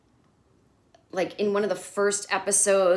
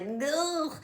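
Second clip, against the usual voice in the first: high, soft groan of disgust; a cringing ugh; sharp cringe at the screen; 8 semitones higher, 5 dB quieter than usual. Retold or imitated. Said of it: "I was like".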